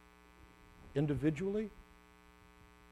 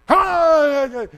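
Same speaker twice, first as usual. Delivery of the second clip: impatient yell; 9 semitones higher, 12 dB louder than usual. Retold or imitated. Parent yelling at slow brother.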